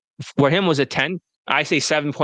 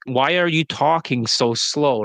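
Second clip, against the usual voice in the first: drawn-out, slow quoted question; imitated slow question; slower than usual. Persian woman questioning the client's slow speech, quoted slowly. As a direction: stretch the words out slowly, quoting her. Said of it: "that Persian woman says".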